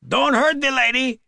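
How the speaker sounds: put-on high, sing-out Spanish accent; syrupy Spanish accent. A narrator's reading. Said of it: "sang out in a syrupy Spanish accent".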